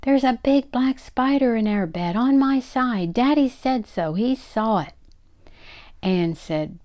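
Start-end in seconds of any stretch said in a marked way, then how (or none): 0.0-4.9 high-pitched alarmed yell. Acted. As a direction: cry out in a hammed-up panic. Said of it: "began pounding on the bed and yelling".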